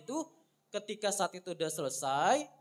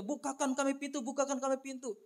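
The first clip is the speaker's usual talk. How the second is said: higher, pleading call; pleading cry; 6 semitones higher, 3 dB quieter than usual. A narrator's reading. Virgins begging at the door, higher and pleading. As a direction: knock and beg to be let in. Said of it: "mempelai yang berteriak-teriak".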